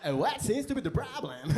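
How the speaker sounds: high, loud put-on American accent; fake American accent. Greeting the traffic cop, a hammed-up American accent. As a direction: overdo a stiff American accent. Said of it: "why are you talking like that".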